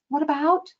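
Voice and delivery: high "What about?" lifting at "about"; questioning rise. Shown as a demonstration. Coach imitating staff rescheduling patients, rising. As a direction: sound unsure, lift the end. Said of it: "people are needing to reschedule".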